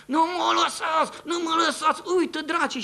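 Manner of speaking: high, loud pleading shout of a dying man; terrified cry. Retold or imitated. Dying old man shouting in terror at devils, high and loud. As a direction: cry out in terror, begging not to be left. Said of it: "șaptesprezece zile a strigat în continuu".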